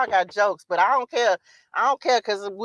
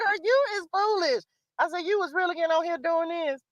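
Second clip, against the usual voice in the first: high teasing scold through laughter; mock scold her younger self; laughing scolding; 8 semitones higher, 5 dB quieter than usual. Scolding her old self, high and laughing.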